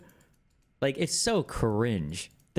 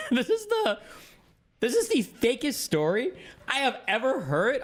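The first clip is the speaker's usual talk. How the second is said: high, drawn-out mock disbelief after a laugh; replaying his own incredulous reaction, pitched up; 11 semitones higher than usual. Retelling his reaction to the story, high mock disbelief. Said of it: "And I was like".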